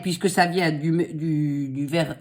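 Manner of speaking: ordinary delivery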